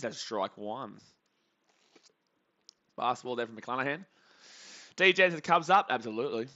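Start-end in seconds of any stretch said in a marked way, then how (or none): none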